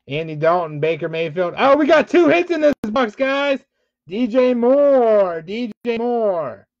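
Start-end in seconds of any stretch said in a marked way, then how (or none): 1.6-3.6 much higher and louder, thrilled at the pull; excited. Real, not acted. Host thrilled by two hits in the box, excited outburst. 4.1-6.7 excited announce. Real, not acted. Announcing the hit card.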